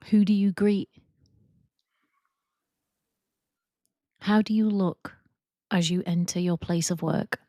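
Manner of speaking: calm, even guided meditation voice with long pauses; guided visualization. Acted. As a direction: ease them into imagining, leaving space after each prompt.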